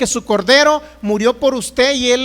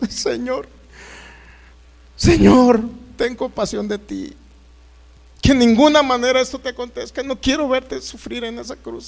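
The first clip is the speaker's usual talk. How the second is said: almost crying; 3 semitones higher, 3 dB louder than usual. Acted. Peter begging the Lord not to go to the cross, almost crying. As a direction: beg desperately, building to an outcry on each "no". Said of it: "Pedro estaba casi llorando".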